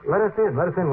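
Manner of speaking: ordinary talk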